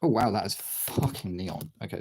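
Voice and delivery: normal talking voice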